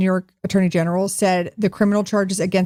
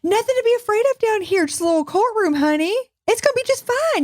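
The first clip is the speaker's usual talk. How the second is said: sugary mock reassurance; 12 semitones higher than usual. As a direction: coo it like a fake-sweet host luring her in. Mock sweet coaxing.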